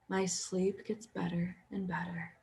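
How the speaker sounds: whispered affirmation; barely voiced, drifting toward sleep. Shown as a demonstration. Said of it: "repeating that in your mind's eye".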